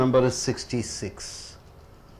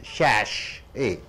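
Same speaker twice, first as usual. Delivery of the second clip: Sachet split as SASH, then a light ay; stress on first syllable; 5 semitones lower, 3 dB quieter than usual. Shown as a demonstration. Teacher showing where the stress goes, stress on first syllable. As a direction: lean on SASH in Sachet, drop the ending. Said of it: "The first syllable should be stressed".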